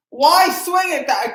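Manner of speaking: slow, high, pained question; exasperated plea. Real, not acted. Fan groans at his slugger chasing a bad pitch, exasperated. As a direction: beg the batter to explain himself.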